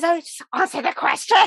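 exasperated demand. Retold or imitated. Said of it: "she goes".

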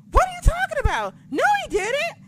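high-pitched acted-out astonishment; shrieky disbelief. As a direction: squeal it out, refusing to believe the news. Retelling her disbelief at the news.

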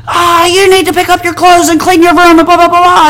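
high, loud nagging mom; mom scolding. Retold or imitated. Mom yelling about messy room.

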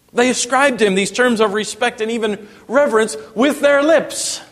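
high, driving pulpit delivery; impassioned preaching. Real, not acted. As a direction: press the questions on the congregation with rising fervor. Preaches at full force.

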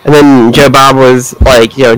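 ordinary talk, nothing marked

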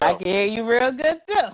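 stage voice. Shown as a demonstration. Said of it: "I'm just going to use my stage voice".

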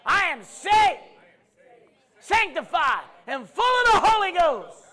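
loud, high, slow declarations shouted out; preaching at full force. Acted. Preacher leading the congregation, full-force preaching. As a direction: proclaim each line like a battle cry.

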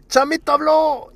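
high, slow, loud tattling shout; shouting accusation. Retold or imitated. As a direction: call him out loudly to the class. Kids accusing Chamito of talking.

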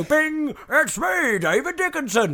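high, lightly voiced TV presenter announcing himself; David Dickinson voice. Host pretending to be the presenter, lightly voiced and high. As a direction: pitch it up like a cheery TV host. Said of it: "Can't do the accent yet".

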